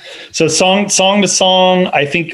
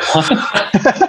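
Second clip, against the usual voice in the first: a much higher, laughing Huh? of mock surprise; baffled huh; 12 semitones higher than usual. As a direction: replay your own stunned reaction with a laugh. Reacting to surprising phone news.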